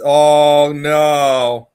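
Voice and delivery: loud shocked oh no; loud real dismay. Real, not acted. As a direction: blurt it out in dismay. Shocked by screenshot.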